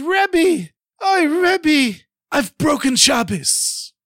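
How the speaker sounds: high, loud, desperate wail; frantic wailing cry. A narrator's reading. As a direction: cry out like a madman. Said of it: "ran inside the shul like a madman".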